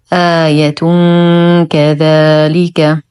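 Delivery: "آية كذلك" joined with a held hum before ك; full nasal ikhfa. Shown as a demonstration. Teacher models joining for learners, nasal hum in "آية كذلك". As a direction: recite "آية كذلك" slowly, hiding the noon. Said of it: "إخفاء حقيقي مع غنة كاملة مرققة".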